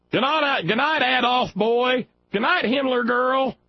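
high, sing-song Waltons bedtime goodnight; mock Waltons goodnight. Mocking the protesters as a right-wing Waltons family, high sweet goodnights. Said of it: "we would have been the Waltons".

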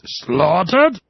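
"Slaughtered" mimicked high and loud, pitch lifting at the end; imitated pronunciation. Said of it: "I just love the way he says".

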